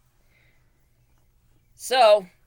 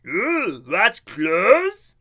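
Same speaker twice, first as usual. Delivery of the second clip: higher, loud wondering dopey voice; a slow-witted discovery; dim bartender; 7 semitones higher, 9 dB louder, much slower than usual. Retold or imitated. Bartender learning what clothes are, high and loud. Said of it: "the bartender was like".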